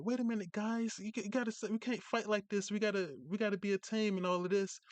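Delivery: high-pitched playful imitation of a wrestler calming a ring argument; mock pleading wrestler. Retelling a wrestler's plea in the ring, high mock voice. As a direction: squeak it out like a wrestler begging for teamwork. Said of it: "He's like".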